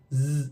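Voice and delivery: long low zzz buzz; vibrating z sound. Shown as a demonstration. Teacher demonstrating the vibration, long z buzz. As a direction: hold a voiced z hum. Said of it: "this vibration".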